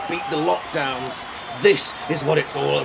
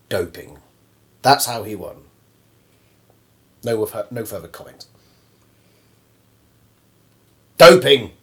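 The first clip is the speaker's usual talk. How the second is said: lower, sulky loser grumbling; sore loser; 6 semitones lower than usual. Acted. Beaten competitor answering the interviewer, low and bitter. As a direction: mutter the excuse like a bitter loser. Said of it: "any comments".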